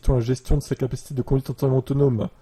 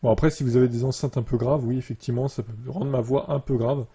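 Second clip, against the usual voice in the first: normal talking voice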